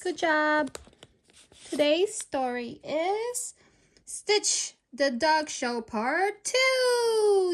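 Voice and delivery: slow, bright storyteller intro; storyteller greeting little listeners; reading to children. Acted. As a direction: announce the story to kids.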